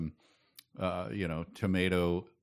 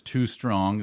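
usual speaking voice

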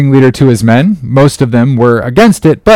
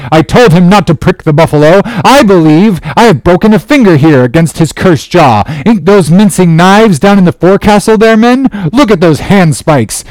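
raised, agitated mutineer's voice; jerked-out sentences; 6 semitones higher than usual. A narrator's reading. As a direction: jerk out each protest at the captain. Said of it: "jerking out such sentences as these".

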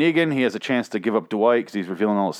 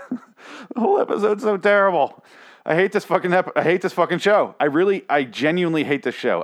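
ordinary delivery

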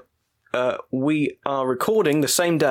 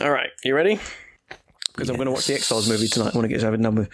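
ordinary talk

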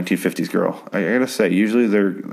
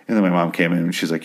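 ordinary talk

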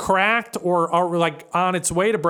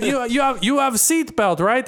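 higher-pitched imitated Russian driver's accent; 5 semitones higher than usual. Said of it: "this old Russian dude".

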